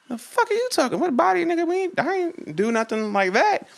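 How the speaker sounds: very high, squeaky protest; mock indignant denial. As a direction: act out baffled denial way up high. Acted denial to friend on phone.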